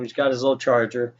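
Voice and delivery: ordinary talk, nothing marked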